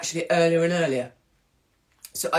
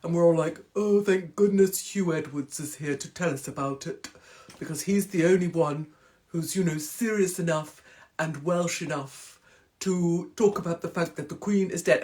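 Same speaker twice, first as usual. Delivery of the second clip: solemn newsreader. Retold or imitated. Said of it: "he's like".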